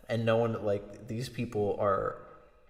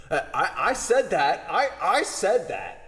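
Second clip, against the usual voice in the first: higher, louder indignant claim; loud protest; 7 semitones higher, 6 dB louder than usual. Retold or imitated. Peele protests out loud to the class, louder and higher. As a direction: insist it was yours.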